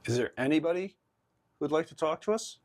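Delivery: high, loud call to the spirits. Real, not acted. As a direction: invite them to speak, loud and high. Calling out to spirits.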